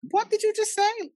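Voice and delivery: very high, loud incredulous retort; re-enacted squawk of indignation; outraged disbelief. Retelling confronting mom over her euphemism.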